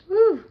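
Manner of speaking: a high, loud, shaky exhale of "woo". Real, not acted. Overwhelmed vlogger fanning herself, high loud woo. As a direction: let out a high, loud woo to steady yourself.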